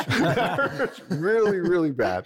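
slow, high, laughing through the words; laughing repeat. Retold or imitated. Guest repeating his pain line to the host, laughing slowly and high. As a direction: laugh at your own pain as you say it again.

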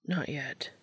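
low, hushed whisper in his ear. A narrator's reading. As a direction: breathe it close and soft. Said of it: "Dean whispered in his ear".

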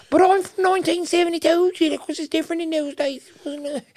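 put-on very high, shrill old Nan scolding; hammed-up granny nagging her grandson; old Nan teasing his tight pants; grandmother voice. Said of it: "they'll go on this whole rant".

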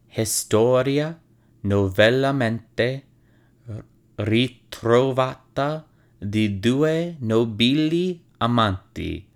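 title spoken slowly in a hammed-up Italian accent. Shown as a demonstration. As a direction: read the Italian title with Italian flair. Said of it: "in my best Italian accent".

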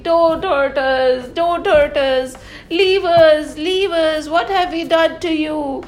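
high, pleading cry for mercy; crying cow. Acted. Storyteller voicing the crying cow to the children, pleading. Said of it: "He heard a loud crying".

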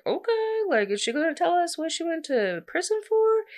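very high, singsong incredulous okay; taken aback. Acted. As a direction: squeak out an awkward, intrigued reaction. Voicing the reader's startled reaction.

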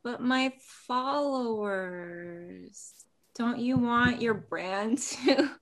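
slow, soft puppy-dog plea; sweet pleading. Acted. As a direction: beg sweetly with big eyes. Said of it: "give the puppy dog look".

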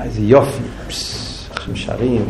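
low, soft awe, trailing into near-whisper; hushed mock admiration. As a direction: imitate a listener quietly savoring the singing. Imitates admiring listeners at singing. (התפעלות שקטה ומבוימת)

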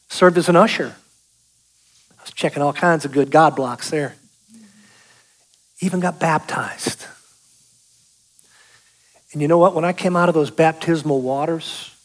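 normal talking voice

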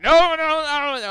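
high, loud string of protests; urgent restraining cry. Acted. Dwarves grabbing their friend at the table, urgent shouting. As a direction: blurt it out to hold him back.

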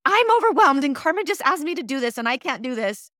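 high, loud, frantic complaint; mock overwhelmed vent. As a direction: play a swamped husband who has hit his limit. Retells man venting to wife.

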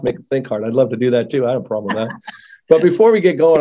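ordinary talk, nothing marked

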